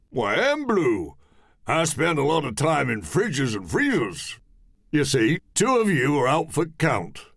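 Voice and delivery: high, pompous Cockney explaining; pompous Cockney man. Acted. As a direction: hold forth importantly. Mister Kane explaining.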